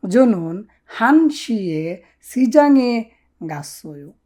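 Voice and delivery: "জনন নেইল হুইসায়ে আনুল কোয়ো" drawn out very slowly; slow Korean model. Shown as a demonstration. Teacher models the Korean answer very slowly. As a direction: dictate the Korean line word by word. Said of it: "কিভাবে বলবেন?".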